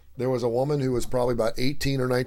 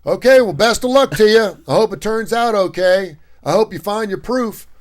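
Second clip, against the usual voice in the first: higher, louder, dismissive well-wishing; loud send-off; 9 semitones higher, 9 dB louder than usual. Retold or imitated. A worker gives up on the girl, loud and dismissive. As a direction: wish her luck and give up on her. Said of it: "he just like".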